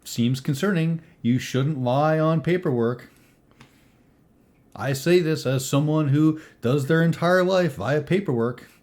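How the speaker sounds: a hint of a pleased employer; lightly voiced employer character. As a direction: talk up the new recruit with confidence.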